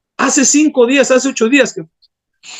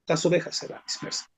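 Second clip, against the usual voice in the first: hushed, much softer warning; whispered scripture; 7 semitones lower, 15 dB quieter than usual. Acted. Pastor warning fellow ministers, whispering the verse. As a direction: drop to a near whisper on the scattered sheep.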